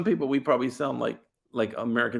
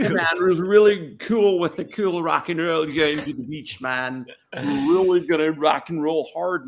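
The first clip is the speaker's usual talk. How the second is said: overeager mock-European American accent, starting loud; European faking American; 4 semitones higher, 3 dB louder than usual. European guy inviting pals for hamburgers, faked American accent. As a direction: try too hard to sound American, European accent showing. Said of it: "European guy trying to be real American".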